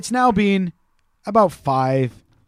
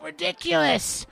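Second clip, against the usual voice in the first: put-on whiny Australian kid; 10 semitones higher, slower than usual. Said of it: "He's like".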